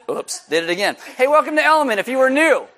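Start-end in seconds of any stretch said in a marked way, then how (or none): 1.2-2.7 high, loud, over-bright greeting; loud, hammed-up friendly hello; mock-cheery welcome. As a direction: cover a gaffe with a big, high welcome. Jokey welcome after offensive bit.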